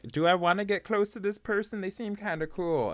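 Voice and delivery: high, loud, eager wondering aloud; mock inner debate. Worker debating getting close to a colleague, high mock wondering. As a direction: pitch it up as a tempted self-question.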